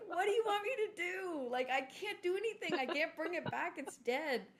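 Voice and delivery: helpless parent arguing back; exasperated protest. Retold or imitated. Retold quarrel with crying kids.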